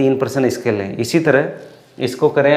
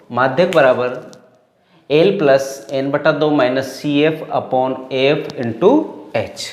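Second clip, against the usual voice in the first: slow formula dictation; slower than usual. Shown as a demonstration. Teacher reading the formula again to students, slow and deliberate. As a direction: spell the formula out slowly, term by term. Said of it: "वापस देखो".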